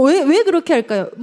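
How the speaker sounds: ordinary talk, nothing marked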